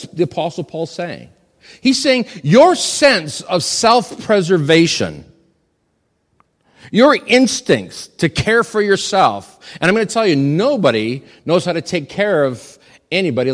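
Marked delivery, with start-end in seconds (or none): 1.8-11.7 high, loud, slow emphasis; emphatic preaching. Acted. Preacher pressing home Paul's meaning to husbands, loud and slow. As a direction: drive each word home from the pulpit.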